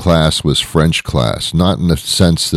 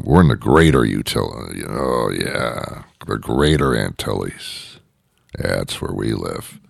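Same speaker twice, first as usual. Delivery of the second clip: low, soft, self-satisfied murmur; smug rich resident; 6 semitones lower, 5 dB quieter than usual. Acted. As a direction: quietly savour your superior address. Mimics smug rich Greater Antilles residents.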